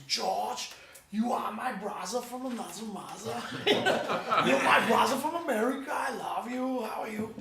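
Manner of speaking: imitating a thick German accent; lightly put-on German accent. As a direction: do the label boss in thick German. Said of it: "that thick German accent".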